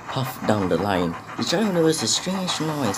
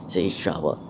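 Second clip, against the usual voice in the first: ordinary delivery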